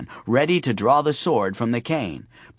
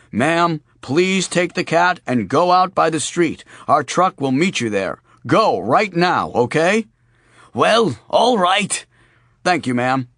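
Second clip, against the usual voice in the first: gas company voice; 5 semitones higher, 5 dB louder than usual. Acted. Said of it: "his best gas company voice".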